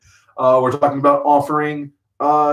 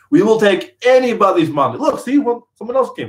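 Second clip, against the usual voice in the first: joking greed; 7 semitones higher than usual. Pretend.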